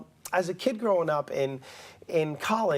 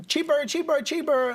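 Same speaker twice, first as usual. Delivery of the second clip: SNL skit impression; 10 semitones higher than usual. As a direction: bark it like the Saturday Night Live cook. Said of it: "if you ever seen Saturday Night Live".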